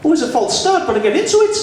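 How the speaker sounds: very high put-on Geordie voice; Geordie accent. Shown as a demonstration. Comedian excusing a shaky start, high Geordie accent. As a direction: keep the comic Geordie going, pitched high. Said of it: "Geordie's easy".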